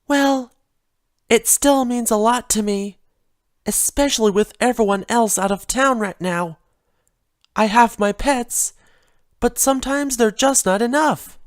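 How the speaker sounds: a timid pony fretting over a broken vase; Fluttershy's voice. Acted.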